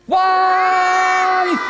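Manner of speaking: high calling shout; shout for wife. Acted. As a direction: call out to the wife. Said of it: "Shout for the wife".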